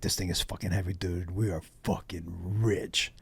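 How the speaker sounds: low, very soft awed retelling; hushed excitement. Retold or imitated. Joey retold, hauling the stolen safe, hushed excitement. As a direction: savour it under your breath. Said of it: "they were so excited".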